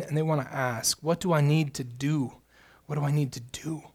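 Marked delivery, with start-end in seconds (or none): 2.9-3.8 softer repeat, far smaller and drawn inward; quiet echo. Pretend. Preacher echoing the seeker's question, quiet inward repeat. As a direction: say it again under your breath, almost to yourself.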